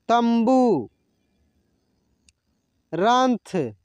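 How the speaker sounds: each word said alone, careful and drawn out; slow teacher-style list reading. Shown as a demonstration. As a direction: model every word for students to repeat. Second word list read aloud.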